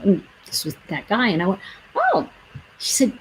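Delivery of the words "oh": surprised oh. Retold or imitated. Taken aback on hearing news.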